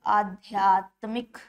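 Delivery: आध्यात्मिक drawn out slowly with a long आ; slow dictation. Shown as a demonstration. Teacher shows students the long vowel, slowly. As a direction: spell out आध्यात्मिक while writing. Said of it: "बड़ा आ जाएगा".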